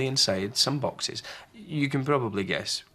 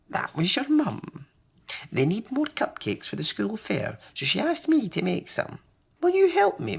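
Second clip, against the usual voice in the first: bright granny voice rising at the end; high cheerful old lady; wee Granny; 11 semitones higher than usual. Acted. Wee Granny asks help.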